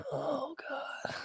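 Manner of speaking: high, soft exasperated groan; weary dad sigh. Retold or imitated. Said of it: "Tom Hanks is just like".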